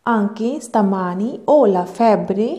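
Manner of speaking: very slow, careful Italian with clear pauses; slow model reading. Shown as a demonstration. Teacher models the Italian sentence slowly for students. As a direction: spell the sentence out slowly for learners. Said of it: "ਤੁਸੀਂ ਧਿਆਨ ਦਿਓ ਕਿ ਮੈਂ ਕਿੱਦਾਂ ਪੜ੍ਹ ਰਹੀ ਹਾਂ".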